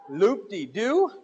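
slow, curious rising question; drawn-out curious echo; mock interested husband. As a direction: feign great interest. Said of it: "you're like".

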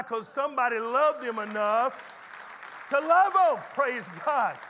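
slow build that swells to a loud peak; rising preach. Real, not acted. Preacher swelling up to the congregation. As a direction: lift it and land it hard.

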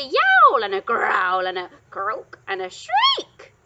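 each word voiced like its own cry, loud; yowl, growl, croak and shriek the words; terrible beast noises. A narrator's reading. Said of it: "its terrible voice".

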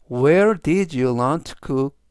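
very slow, each word separated; slow, clear reading. Shown as a demonstration. Teacher slowly reads the sentence three times for students. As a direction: read slowly so students can follow. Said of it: "제가 역시 천천히 세 번씩 읽어드리고요".

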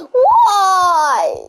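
laughing, crying shout; half laugh, half scream; scared yelp. Real, not acted.

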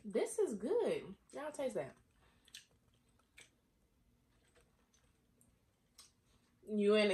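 just swallowed a drink; speaking after drinking; right after a sip. Real, not acted. Seen 2: sips at -7 to -3, then talks holding glass.

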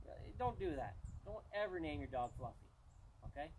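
ordinary talk

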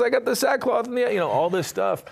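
high wailing Yiddish voice; Yiddish accent. Retold or imitated.

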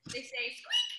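high, soft teasing squeak; mock squeak voice. Teacher teases kid about lion sound, high squeaky voice.

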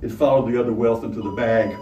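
plain reading voice

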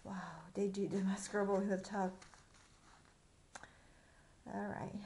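ordinary delivery, nothing marked

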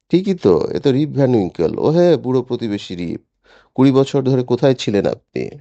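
put-on high-pitched, excited old crone; doddering old woman. A narrator's reading. Old woman recognising her long-lost neighbour Rip, high-voiced exclamation. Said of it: "এক থুরথুরে বুড়ি".

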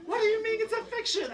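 high laughing disbelief; laughing incredulous. Retold or imitated. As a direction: cousin puzzled, quoted through laughter. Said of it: "And she was like".

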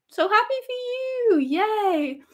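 giddy squeal. Real, not acted. Excited for winner.

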